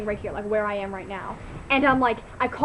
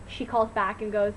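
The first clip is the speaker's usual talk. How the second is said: normal talking voice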